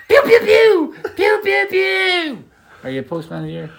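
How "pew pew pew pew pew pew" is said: pew pew gunshots. Pretend. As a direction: fire off cartoon pistol shots. Imitating cowboy gunshots.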